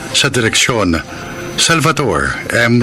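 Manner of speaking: ordinary delivery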